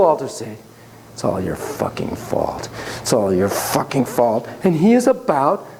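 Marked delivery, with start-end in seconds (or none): 1.1-4.5 low, slow, quiet fury; acted low, deliberate threat; menacing accusation. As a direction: blame him with contained menace. Enraged stepdad stares down, accuses therapist.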